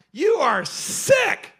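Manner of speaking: slow, high, loud condemnation; appalled outburst. Retold or imitated. Speaker confronts minister who faked healings, appalled. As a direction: draw out each word in disgust.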